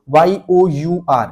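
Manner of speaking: very slow letters वाई ओ यू आर, one at a time; spelled slowly. Shown as a demonstration. Typing teacher shows students the right reading, slow spelling. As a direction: spell "your" deliberately, letter by letter. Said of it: "ऐसे पढ़ो".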